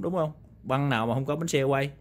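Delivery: normal talking voice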